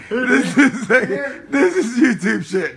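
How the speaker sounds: laughing while talking; cracking up mid-sentence. Real, not acted. Filming his drunk friend, laughing.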